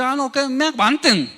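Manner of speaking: high-pitched dismissive retort; mock defiance. Person expecting everyone to endure them, mock defiant. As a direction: wave it off in mock entitlement. Acted person demanding to be tolerated.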